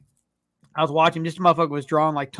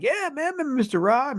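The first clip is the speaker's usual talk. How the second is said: a lightly voiced flash of recognition; sudden recognition; 8 semitones higher than usual. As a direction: pitch jumps high on yeah man, then eases. Recalling the fifth-grade teacher.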